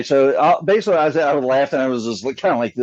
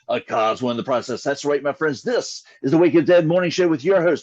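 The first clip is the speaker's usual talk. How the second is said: rock-radio morning show patter; polished announcer voice with swooping pitch. Shown as a demonstration. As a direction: rattle off a showy DJ intro. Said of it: "like, radio announcer. Watch him do some intro".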